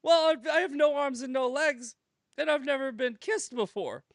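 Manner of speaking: high, loud, plaintive put-on voice; mock tearful wail. Crying woman to man who hugged her, mock tearful wail. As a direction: wail the complaint for comic effect. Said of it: "she's still crying".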